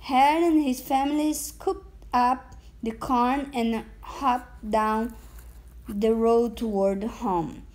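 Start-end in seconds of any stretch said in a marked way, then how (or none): none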